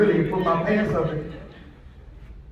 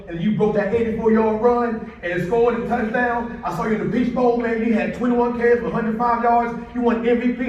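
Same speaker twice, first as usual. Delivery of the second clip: excited recounting; 7 semitones higher, 7 dB louder than usual. Retold or imitated. James excitedly recounting stats.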